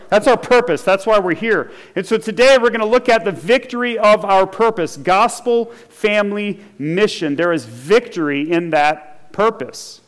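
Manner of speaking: normal talking voice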